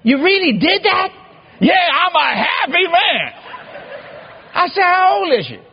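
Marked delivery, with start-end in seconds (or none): 0.0-1.1 high, astonished mock question; retold incredulity. Son checks that Dad really married, acted astonishment. 1.6-3.3 high, slow, drawn-out imitation of elation; excited old father. Father announces his wedding to his son, hammed-up elation. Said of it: "He's excited". 4.8-5.6 high, incredulous mock questioning; retold astonishment. Son asks Dad about the new bride, acted astonishment.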